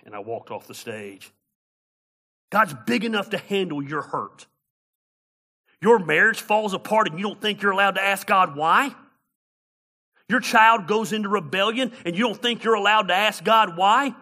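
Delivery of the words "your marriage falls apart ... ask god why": raised, loud, challenging rhetorical questions; impassioned preaching. Real, not acted.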